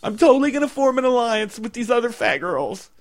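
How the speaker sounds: lightly voiced jealous girl; mock jealous contestant. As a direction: whine with fake jealousy.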